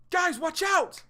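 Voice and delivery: high, loud alarm call; sudden high warning shout. Acted. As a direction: yell it to warn the group.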